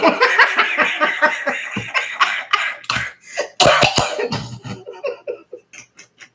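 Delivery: breathless, helpless cackle that goes on and on; real fit of laughter; long laughing fit. Real, not acted. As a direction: lose it completely and keep laughing. Reacting to a wild story.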